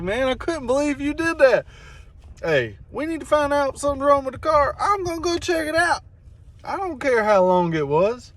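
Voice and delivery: lightly voiced friend in disbelief; imitating Billy. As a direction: play Billy amazed. Billy quoted laughing in disbelief.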